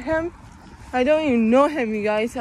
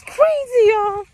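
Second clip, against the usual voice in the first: much higher and louder burst; amazed; 8 semitones higher, 5 dB louder, slower than usual. Real, not acted. Vlogger telling viewers about a coworker, amazed. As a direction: exclaim it to the viewers, pitched way up.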